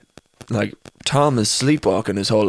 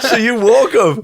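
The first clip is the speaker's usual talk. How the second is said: high, slow words through laughter; laughing at the mix-up; 11 semitones higher, 4 dB louder, much slower than usual. Real, not acted. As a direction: say it while cracking up. Laughing at friend's story.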